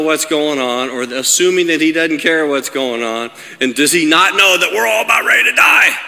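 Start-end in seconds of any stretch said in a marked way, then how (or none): 3.6-6.0 acted panicked shout, rising to the end; freaking out. Disciples freak out as Jesus sleeps, acted panicked shout. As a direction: build to a mock-desperate cry. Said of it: "They're freaking out".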